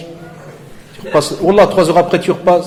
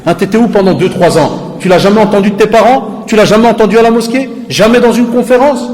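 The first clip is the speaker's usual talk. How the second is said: loud, high, incredulous questioning; indignant rebuke; 7 semitones higher, 6 dB louder than usual. Real, not acted. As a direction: fire each question harder. Rebuking the youth.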